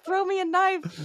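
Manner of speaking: high mock plea; imitating Jay. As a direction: toss off a high squeaky request. Imitating Jay Baruchel's line.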